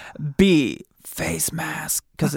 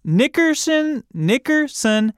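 high drawn-out name; 10 semitones higher, slower than usual. Pretend.

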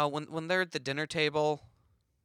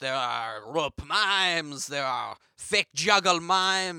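slow, loud French mime; French accent; 4 dB louder, slower than usual. Acted. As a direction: declaim slowly in a hammy French accent. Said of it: "my French accent isn't that good".

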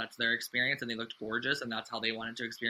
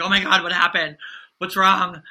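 imitating a panicked boyfriend, higher and louder; worried boyfriend; 6 semitones higher, 13 dB louder than usual. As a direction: play the alarmed boyfriend asking what's wrong. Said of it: "He was like".

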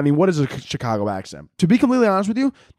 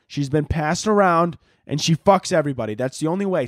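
normal talking voice

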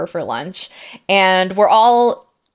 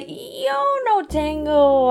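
drawn-out, halting Spanish, pitched high; slow, hesitant start; 7 semitones higher, much slower than usual. Retold or imitated. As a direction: stall on each word in learner's Spanish. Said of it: "I start to slowly go into".

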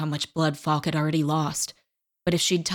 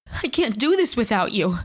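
higher and louder, sobbing plea; 5 semitones higher, 4 dB louder than usual. A narrator's reading. Dakota begging dying Fox, sobbing. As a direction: let the tears break through. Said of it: "she sobbed".

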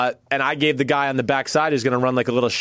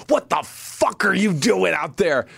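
slow, higher-pitched mock yell; imitating angry coach; 5 semitones higher, slower than usual. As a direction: chew out the quarterback as the coach, drawn out. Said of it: "I got yelled at".

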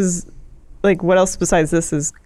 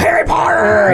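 shouted mock Dumbledore yelling a name; mock scream; 3 dB louder than usual. Imitating Michael Gambon yelling at Harry, mock shout. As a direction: bark the name like an angry headmaster. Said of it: "He's screaming at him".

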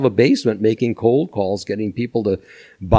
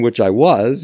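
ordinary talk, nothing marked

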